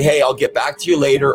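ordinary delivery

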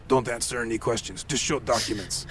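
low, hushed driver's aside; hushed warning. Retold or imitated. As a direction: warn them under your breath. Driver quietly warns passengers.

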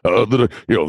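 ordinary talk, nothing marked

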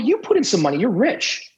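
retorting to the rich sponsor, indignant. Retold or imitated. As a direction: toss it back at him, incredulous. Arguing back at sponsor.

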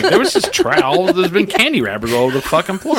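mock dawning surprise; realization. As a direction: imitate the mom realizing. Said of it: "You're like".

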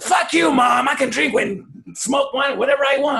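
high, loud bratty shout; mock teen yell. Friends shout at their mother, high and loud. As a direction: yell back like a defiant teenager.

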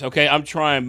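usual speaking voice

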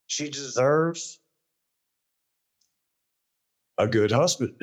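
choked up, voice catching; fighting back tears while speaking; holding back sobs. Real, not acted. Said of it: "sorry, I get emotional".

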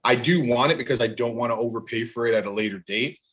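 ordinary talk, nothing marked

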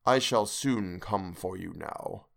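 low, slow, distant ghostly voice; ghostly face. Acted. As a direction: drag out each word like a phantom. Ghostly lips quoted in memory.

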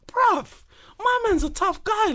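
high-pitched, drawn-out retold protest; mock disbelief. Friend defending his man's toughness, mock disbelief. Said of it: "And you're like".

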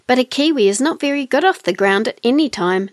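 ordinary delivery, nothing marked

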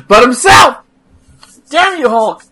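loud hammed-up outrage; mock rant. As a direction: shake your fist at Hulk. Mock rant at Hulk Hogan.

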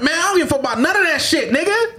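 high, emphatic dismissive rant; hyped-up dismissive outburst; mock rant. Telling kids to fight back.

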